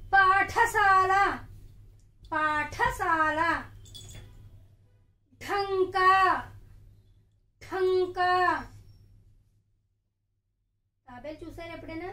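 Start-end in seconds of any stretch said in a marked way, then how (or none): 0.1-8.6 పాఠశాల and Dhanka stretched out slow and loud; slow loud teacher drill; slow naming. Shown as a demonstration. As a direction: call out each name slowly for children to echo. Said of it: "వాటి పేర్లు కూడా మళ్ళీ చెప్తాను".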